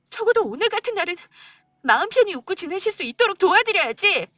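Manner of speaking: wife tearfully confronting husband on mother's birthday. Acted. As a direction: pour out the hurt, close to crying.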